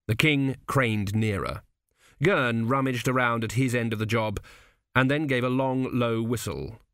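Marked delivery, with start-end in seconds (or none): none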